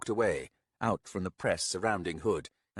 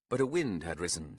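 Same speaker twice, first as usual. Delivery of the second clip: usual speaking voice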